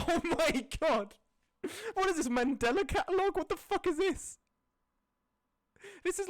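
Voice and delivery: high and loud, the disbelief spilling out; blurting in horrified amusement; shocked outburst. Real, not acted. Shocked by a bizarre image.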